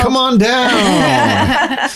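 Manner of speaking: slow, high, drawn-out game show call; game show announcer. Pretend. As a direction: stretch it like a TV host.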